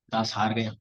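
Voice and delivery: soft, low admission of defeat; humble surrender. Retold or imitated. Defeated scholar bows to Swamiji, retold in soft surrender. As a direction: drop the voice low and soft, submissively.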